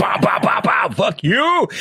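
whispered gun noises then high shout; gunfire sounds and shout. Retold or imitated. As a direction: shoot bow bow, then yell fuck you.

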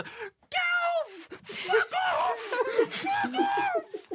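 very high, screaming rage; shrieking fury. Acted. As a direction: shriek at the swarming kids. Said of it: "swarm Wong and say".

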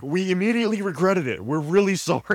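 high, loud pleading apology; imitating an overeager, contrite woman; mock apology. Acts lady's apology for bombing.

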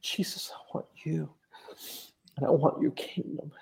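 whispered, voice breaking with emotion; tearful whisper. Real, not acted. Answering Jesus in his retold vision, tearful whisper. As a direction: barely get it out through tears. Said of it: "I'm getting emotional now".

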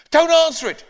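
high, loud urgent plea; panicked boy. Acted. Ben begging Granny not to open the door, high and panicked. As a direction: blurt it out in a panic.

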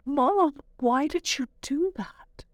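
high, slow, hurt little voice; mother imitating her crying son; tearful child's plea. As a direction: plead like a crying kid.

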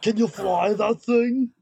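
put-on deliberate Austrian-accented question; Schwarzenegger impression. Action hero asks about the ship in a slow Austrian accent. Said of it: "Arnold Schwarzenegger?".

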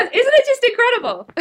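high, loud and thrilled; real delight about a new plugin; excited. Real, not acted. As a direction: gush about it, nearly squealing.

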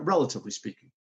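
ordinary talk, nothing marked

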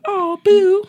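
high sweet "boo"; cutesy. Retold or imitated. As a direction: coo it sweetly. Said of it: "And now it's like".

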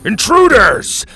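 loud, high, slow shout; yelling. Acted. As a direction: yell the alarm at the intruders. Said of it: "the guards yelled".

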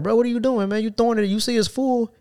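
high exasperated retelling. Retold or imitated. Narrator scolding coworker at the full bin, high exasperated. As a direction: call him out, fed up. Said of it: "I'm like".

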